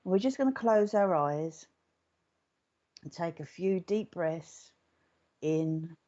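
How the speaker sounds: soft, unhurried meditation voice; guided meditation. Real, not acted. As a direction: settle listeners gently, softening into the breath. Begins leading the meditation.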